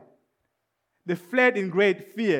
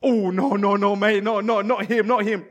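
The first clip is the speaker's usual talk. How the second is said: backing off in a hurried run of no's; mildly panicked, comic protest; mock fearful refusal; 4 dB louder than usual. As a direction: play a soldier chickening out, a bit loud. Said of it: "in fear".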